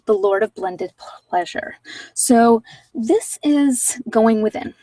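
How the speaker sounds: normal talking voice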